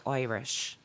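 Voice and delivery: "Irish" said as "Oirish" in an Irish accent. Shown as a demonstration. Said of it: "in an Irish accent".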